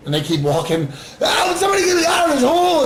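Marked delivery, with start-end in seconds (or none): 1.2-2.8 hammed-up frantic yell; mock panicked shout. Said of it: "And he's screaming".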